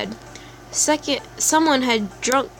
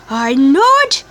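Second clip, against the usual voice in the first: louder, higher frantic crazy voice; crazy person; 4 semitones higher, 6 dB louder than usual. Acted. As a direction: rant like someone losing her mind. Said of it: "I'm saying it like a crazy person".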